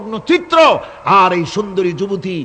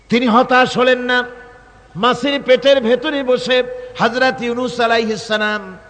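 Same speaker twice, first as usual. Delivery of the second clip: high, raised declamation in separated phrases; impassioned preaching; 9 semitones higher than usual. Real, not acted. Preacher tells crowd of Yunus and the fish, impassioned preaching. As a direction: proclaim it to the crowd, pausing between phrases.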